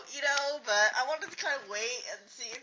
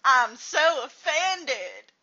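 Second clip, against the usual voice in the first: slow, louder drawn-out offended line; mock offended, better take; 2 semitones higher, 6 dB louder, much slower than usual. A second, louder take of a mock offended line. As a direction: lean harder on so. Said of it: "I did that better than you".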